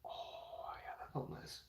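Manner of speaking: whispered relief after scratching; soft whispered sigh of relief. Real, not acted. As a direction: breathe it out under the breath. Relief after scratching itchy shoulders.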